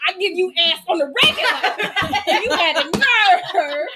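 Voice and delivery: loud, high, laughing indignation; hammed-up scolding of an ungrateful partner; mock outrage. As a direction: raise it up and stretch the ending in disbelief.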